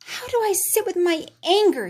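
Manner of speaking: put-on high child voice, a touch puzzled; a boy asking himself, lightly voiced; wondering aloud. Boy alone in his room, lightly voiced child wondering. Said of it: "he wondered".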